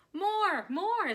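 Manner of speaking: high, loud cheering call; crowd calling out. Acted. Delighted shoppers demanding an encore, high loud cheers. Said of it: "shoppers called out".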